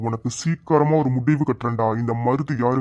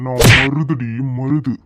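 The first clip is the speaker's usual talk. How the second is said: ordinary delivery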